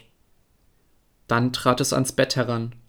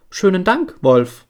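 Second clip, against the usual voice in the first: put-on lightly raised, higher girlish voice; little girl voice; 5 semitones higher, 5 dB louder than usual. Little girl thanks the wolf, lightly voiced high and girlish. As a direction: play the innocent little girl greeting the wolf.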